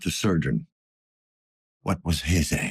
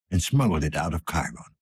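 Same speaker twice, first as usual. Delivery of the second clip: ordinary talk, nothing marked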